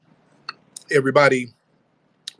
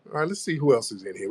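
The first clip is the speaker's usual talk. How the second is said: ordinary delivery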